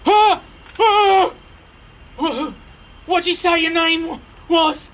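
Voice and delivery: high, loud startled stammer; yelp, then stumble over the question up high; dazzled knight in a high, flustered voice. Acted. Knight startled by flowing light.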